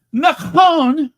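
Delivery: says speaking of Macron with a French accent, loud and high; French-accented Macron, nasal vowels, dropped r. Shown as a demonstration. Vlogger showing off her French accent to viewers, French accent. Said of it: "that's my French accent".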